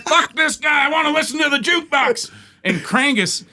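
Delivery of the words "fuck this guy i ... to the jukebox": high imitated yelling; screaming heckler. Bar guy demands the jukebox, mock screaming. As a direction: yell over the comic like a barfly. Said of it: "He just starts screaming".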